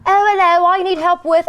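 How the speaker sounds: a hammed-up English caller greeting; imitating an English accent. Caller on the phone speaking in an English accent. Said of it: "they had a very strong English accent".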